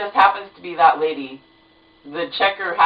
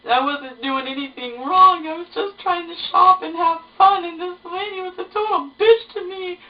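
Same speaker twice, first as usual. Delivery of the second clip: imitating blubbering; 12 semitones higher than usual. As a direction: replay the sobbing complaint up high. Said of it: "I just start blubbering".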